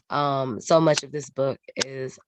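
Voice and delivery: ordinary talk, nothing marked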